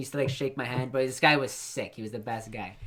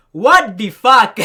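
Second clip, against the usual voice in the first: put-on loud, high, drawn-out Jamaican-accented yell; outraged Jamaican shout; 8 semitones higher, 18 dB louder, much slower than usual. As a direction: imitate an outraged Jamaican yelling from the warehouse. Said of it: "in the warehouse go".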